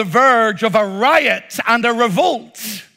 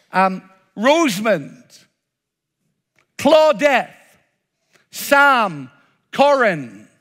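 ordinary delivery

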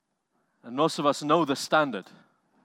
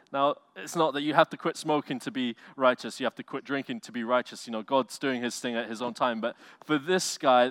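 ordinary delivery

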